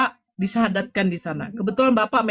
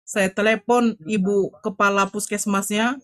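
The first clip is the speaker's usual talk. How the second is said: normal talking voice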